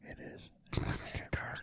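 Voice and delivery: tiny breath-only whisper; very soft, secretive hush; mock ASMR whisper. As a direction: whisper it like an after-dark show. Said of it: "A S M R".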